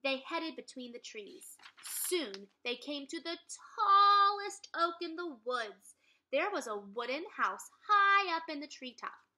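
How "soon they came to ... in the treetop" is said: building wonder. A narrator's reading. Dramatic narration.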